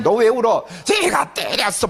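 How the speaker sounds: put-on high, slow little kid explaining why he cries; lightly voiced small child. Preacher imitating a kindergartener explaining his tears. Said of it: "뭐 이런 거 해요".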